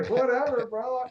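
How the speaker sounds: high, dismissive brush-off; mock dismissive. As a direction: toss it off like it doesn't matter. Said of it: "I'm like".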